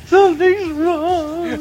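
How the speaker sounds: high, slow, wailing ghost voice; spooky voice. Pretend. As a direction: moan it like a playful ghost. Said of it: "I'm doing my spooky voice".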